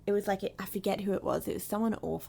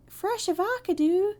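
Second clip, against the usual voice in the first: high quoted vine voice; vine quote; 11 semitones higher, 5 dB louder than usual. Retold or imitated. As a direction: toss off the meme line up high. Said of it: "classic vines".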